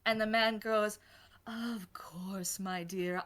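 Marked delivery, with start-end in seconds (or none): 1.5-3.2 hushed whispered endearment. Acted. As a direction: breathe it, barely voiced. GM voices the vampire man.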